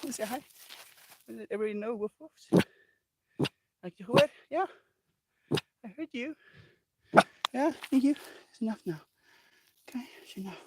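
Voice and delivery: high soft coaxing dog-talk. Acted. Owner cooing to her puppy, high and soft. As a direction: coo sweetly at the puppy.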